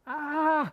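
high, drawn-out creature squeal; little beast's acted shriek. A narrator's reading. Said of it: "kreeschte het beestje".

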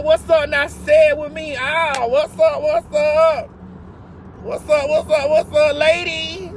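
high, chanted what's up; hype greeting. Real, not acted. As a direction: hype the crowd, call it out.

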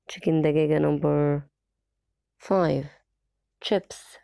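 ordinary talk, nothing marked

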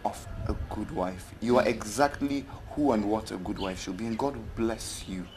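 soft, low, tender praise; hushed, grateful sincerity; heartfelt blessing. Acted. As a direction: bless them quietly and sincerely. Blesses friend's wife for patience.

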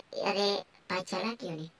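ordinary talk

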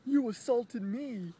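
high, loud, slow whiny accusation; hammed-up girlfriend accusing him; mock accusing ex. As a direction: mimic her shrill, drawn-out accusation. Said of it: "and then, and then".

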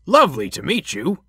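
high, loud, friendly voice; smiling introduction. A narrator's reading. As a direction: introduce yourself with a big audible smile. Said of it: "he smiled".

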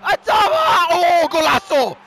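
high, loud celebration; elated high-pitched goal call; excited goal shout. Real, not acted. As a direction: shout it out as the ball goes in.

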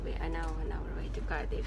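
usual speaking voice

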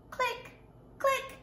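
high little clicking sounds; two quick shutter clicks; playful mouth clicks; camera clicks. Pretend. Pretend camera shutter noise.

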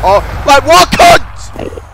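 very high, loud outburst; furious yell. Real, not acted. Gamer just killed by a rival, furious yell. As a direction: explode at the player who beat you.

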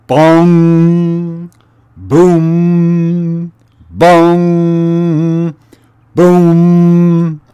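loud imitated bell chimes; bell tolling. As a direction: boom it out like a great bell. Narrator imitates the great bell.

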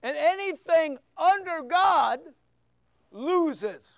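preaching at full force, rising. Acted. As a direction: preach it hard to the congregation.

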